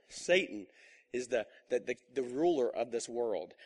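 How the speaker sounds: low, soft and slow; grave, quiet warning. Real, not acted. Pastor warning the congregation about Satan, slow and quiet. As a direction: let it sink in darkly.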